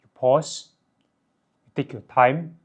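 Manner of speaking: unhurried, with long pauses between phrases; measured, serious delivery; slowing down. Shown as a demonstration. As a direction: take your time, pausing after each phrase. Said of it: "If you slow down, you pause".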